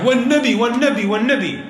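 put-on high-pitched repeated child's plea; pleading child. Child swearing to get money, imitated high pleading. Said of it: "a child comes running towards you saying".

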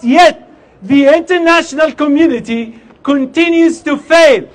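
fiery protest speech; rally the crowd with force; loud soapbox ranting. Acted.

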